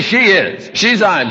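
usual speaking voice

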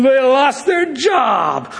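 high, drawn-out mock gloating; sneering glee. Gloating over a disliked person's lost job, malicious glee. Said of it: "Malice is when you laugh and sneer".

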